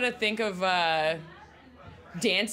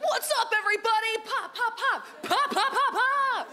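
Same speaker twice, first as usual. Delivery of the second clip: high, full-voiced crowd hype with punched pops; imagined hype greeting; 12 semitones higher than usual. Comic imagining herself firing T-shirts at the audience, high hyped-up call. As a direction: mimic a T-shirt cannon host firing off shots. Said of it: "if I was just like".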